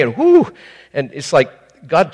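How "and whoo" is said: a loud, high whoo of relief; high hoot of relief; high relieved whoo. Retold or imitated. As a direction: let out a squeaky whoo as if just in time. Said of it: "I've said".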